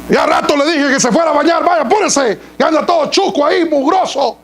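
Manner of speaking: put-on high-pitched, brusque nagging at a child; rough, rapid-fire parental rebuke; harsh parent scolding. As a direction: act out an exasperated parent telling a child off. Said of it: "por qué le hablas así".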